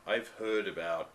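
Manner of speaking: ordinary talk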